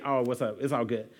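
low, soft brush-off; mock casual reply. Pastor imitating someone's easy casual reply, low and soft. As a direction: toss it off lightly. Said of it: "you just want somebody to say".

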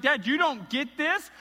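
loud, high outraged questions at his father; angry son. Retold or imitated. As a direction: demand why Dad is celebrating. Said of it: "his response is one of anger".

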